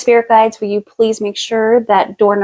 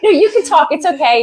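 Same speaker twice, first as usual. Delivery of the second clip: higher, laughing while reassuring; 7 semitones higher than usual. Real, not acted. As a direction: giggle it out kindly.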